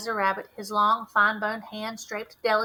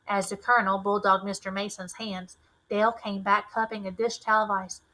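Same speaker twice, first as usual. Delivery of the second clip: ordinary delivery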